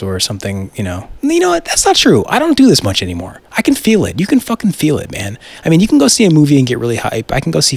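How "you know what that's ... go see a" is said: excited. Real, not acted. Hyped about the new movie.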